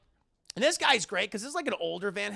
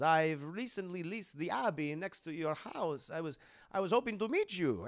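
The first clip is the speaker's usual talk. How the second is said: Dracula voice. Retold or imitated. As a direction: purr it like a courtly vampire. Dracula voiced in retold film.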